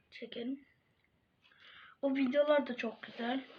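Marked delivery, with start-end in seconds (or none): 0.1-0.6 whispering. Real, not acted. Whispered line end.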